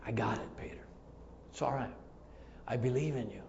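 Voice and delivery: very soft, low reassurance; hushed, merciful tone; tender forgiveness. Acted. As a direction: murmur comfort to a friend who failed. Forgiving eyes of Jesus voiced.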